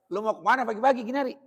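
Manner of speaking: mock a brusque Betawi parent; unaffectionate calling. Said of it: "Manggilnya nggak mesra".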